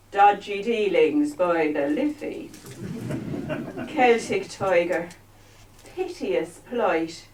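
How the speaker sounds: slow, declaimed verse in put-on Irish; mock Irish accent. Comic recites Blake parody on Ireland's economy, mock Irish. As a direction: recite it solemnly in a stagey Irish brogue. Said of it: "this is another accent I might need to work".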